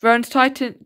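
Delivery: ordinary delivery, nothing marked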